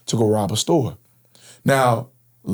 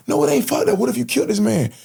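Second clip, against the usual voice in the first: angry retort; 12 semitones higher than usual. Retold or imitated. Arguing back at gunman.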